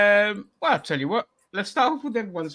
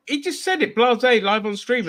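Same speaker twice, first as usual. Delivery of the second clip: incredulous; 6 semitones higher, 4 dB louder than usual. Real, not acted. Incredulous at a blasé confession.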